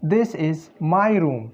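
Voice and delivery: slow, careful this is my room; teacher modelling this is my room at a slow pace; slow model sentence. Shown as a demonstration. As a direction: read this is my room slowly for learners. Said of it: "the sentence is".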